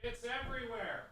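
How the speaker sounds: talking through a real nosebleed; high, hushed, nose bleeding. Real, not acted. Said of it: "Oh no, do I have a nosebleed?".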